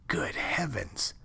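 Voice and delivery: slow, hushed gasp of horror; a soft, slow whispered good heavens; shocked whisper. Acted. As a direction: breathe it out, aghast. Man hears horrid secret.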